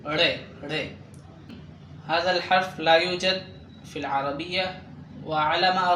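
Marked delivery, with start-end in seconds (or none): 0.1-1.0 ري pronounced as retroflex ڑے; hard Urdu r. Shown as a demonstration. Teacher repeats the unfamiliar letter for learners, hard retroflex ري. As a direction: curl the tongue back for a hard ري. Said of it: "بشدة".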